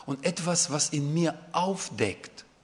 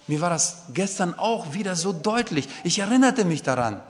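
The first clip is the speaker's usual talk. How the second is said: ordinary talk, nothing marked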